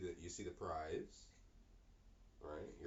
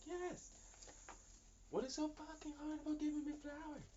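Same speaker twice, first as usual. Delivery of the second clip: much higher, fed-up outburst; exasperated partner. Retold or imitated. Partner fed up about never getting flowers, high exasperation. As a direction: throw up your hands at how simple it is.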